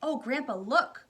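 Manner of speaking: high, loud excited call; excited cry. Acted. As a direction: point it out thrilled. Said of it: "cried Charlie".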